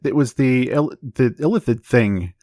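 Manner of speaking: ordinary talk, nothing marked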